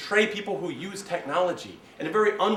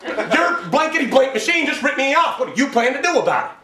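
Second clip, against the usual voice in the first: higher, louder shout at a manager; loud complaint; 8 semitones higher, 5 dB louder than usual. Retold or imitated. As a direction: yell so everyone hears. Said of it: "say in a really loud voice".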